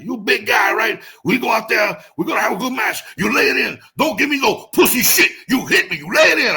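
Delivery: put-on shouted, high Samoan accent. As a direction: yell it like a hyped Samoan wrestler. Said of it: "that thick Samoan accent".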